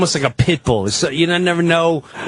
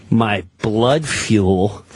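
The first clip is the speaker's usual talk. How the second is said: normal talking voice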